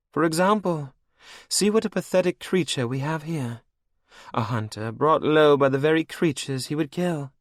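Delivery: high, cold and mocking; villainess queen. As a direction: purr it over your captive like a cruel queen. Anne mocks Logan.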